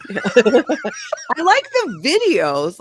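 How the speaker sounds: slow, loud, high laughing words. Real, not acted. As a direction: burst out through real laughter. Laughs defending own videos.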